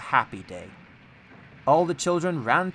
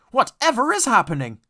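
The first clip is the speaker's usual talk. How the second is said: high, loud alarmed question; startled; 10 semitones higher, 8 dB louder than usual. A narrator's reading. Trucks jolted by Edward, high and startled. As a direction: cry out in alarm.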